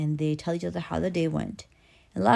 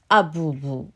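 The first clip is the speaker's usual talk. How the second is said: put-on loud, firm baby babble; baby talk; 5 dB louder, slower than usual. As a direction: announce it as a baby's command. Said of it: "the baby says".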